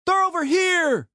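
yelling from afar; high distant shout. Acted. Thug calling out to others.

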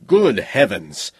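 startled, slow exclamation; taken aback. A narrator's reading. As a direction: blurt it out in surprise. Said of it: "the robot said involuntarily, taken aback".

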